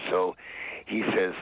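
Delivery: normal talking voice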